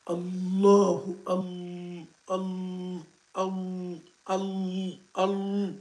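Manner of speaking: "Al-" repeated, doubled "l" held; drill on Al. Shown as a demonstration. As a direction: drill the "Al-" of "Allahu" again and again. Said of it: "il faut le dire avec shidda".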